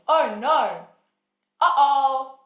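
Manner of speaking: loud, exaggerated exclamations; calling out. Shown as a demonstration. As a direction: call it out big, hamming it up. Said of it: "let's use some calling out phrases".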